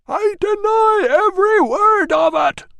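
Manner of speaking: very high, loud, slow furious roar. A narrator's reading. Catavignus roaring his denial from inside. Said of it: "roared Catavignus".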